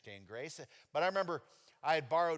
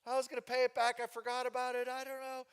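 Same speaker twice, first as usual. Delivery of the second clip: nervous excuses; 12 semitones higher, 6 dB louder than usual. Retold or imitated.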